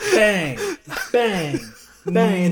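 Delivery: measured mimed gunshots, one at a time; spaced-out gunshots. Retold or imitated. Friend imitates careful shooting at the range, spaced-out bangs. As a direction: say each bang separately with a gap after it. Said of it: "I thought he'd be like".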